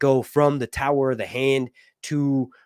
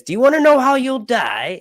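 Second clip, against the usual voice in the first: high, loud eerie question; eyeless lady; 12 semitones higher, 8 dB louder than usual. Retold or imitated. Eyeless lady asking Rhaenyra, in a high loud eerie voice. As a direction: voice the creepy fortune teller, high and loud. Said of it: "She says".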